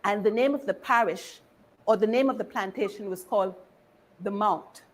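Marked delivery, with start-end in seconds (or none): none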